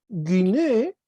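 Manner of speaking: guiné, stress high on the final -né; accent on final syllable. Shown as a demonstration. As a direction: hit the last syllable of guiné. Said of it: "acento agudo". (guiné, con acento agudo en la é)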